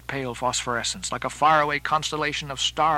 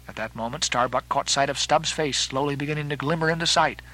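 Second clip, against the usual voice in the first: ordinary delivery, nothing marked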